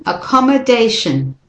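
"accommodation" with schwa in mod and weak shun; repeat-after-me model. Shown as a demonstration. Teacher leads repetition, weak middle vowel. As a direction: say "accommodation" with learners. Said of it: "do it with me".

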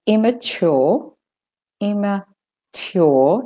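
British-Australian "immature", stretched and rising. Shown as a demonstration. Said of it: "for the British".

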